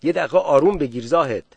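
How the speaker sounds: a man scolding Zahed to calm down; sharp rebuke. Acted. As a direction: cut him off firmly. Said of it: "به زاهد نهیب زد".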